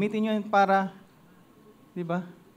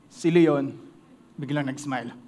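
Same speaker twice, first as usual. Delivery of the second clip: ordinary delivery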